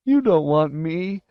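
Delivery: high, slow sobbing plea; whining, tearful boy; sobbed. Acted. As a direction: wail it tearfully. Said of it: "he sobbed".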